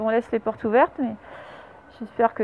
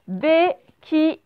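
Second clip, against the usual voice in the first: BÉQUILLE very slow, each syllable set apart; syllable-by-syllable dictation; 3 semitones higher, much slower than usual. Shown as a demonstration. Teacher dictating the third word to pupils, syllable by syllable.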